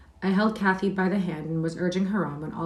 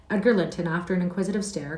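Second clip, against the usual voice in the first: plain reading voice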